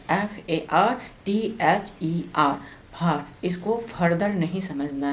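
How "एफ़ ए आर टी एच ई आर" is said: letters "F A R T H E R" spelled out slowly, one by one; slow spelling. Shown as a demonstration. Teacher spelling "further" slowly for students. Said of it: "comparative form हो गया further".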